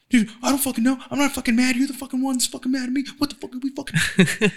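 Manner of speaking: high-pitched, rapid-fire mock tirade; popping off angrily. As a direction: act out a hothead snapping back defensively. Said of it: "that fucking just pops off like".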